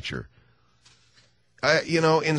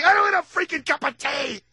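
mock yell. Professor snaps back.

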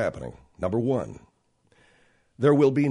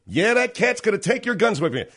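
mock gun nut; 7 semitones higher, 5 dB louder than usual. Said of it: "they figure".

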